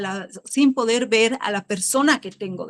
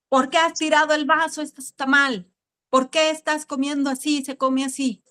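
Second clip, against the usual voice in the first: retold parental scolding, a bit higher and quick; 4 semitones higher than usual. Parent scolding a child over table manners, mock-stern. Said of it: "como hacían mis papás".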